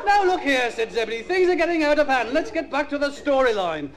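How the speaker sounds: put-on high-pitched kids' TV storyteller; mock children's story narration. Comedian reading a Magic Roundabout script, childlike storytelling voice. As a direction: read it high and simple, as if to little children.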